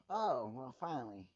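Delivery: ordinary delivery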